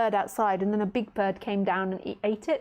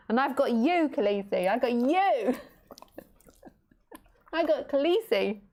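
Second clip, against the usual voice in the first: tender, slightly higher pet talk; affectionate pet talk; 3 semitones higher than usual. Real, not acted. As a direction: lovingly tease the bird. Fond words to the bird.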